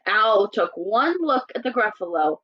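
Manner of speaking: usual speaking voice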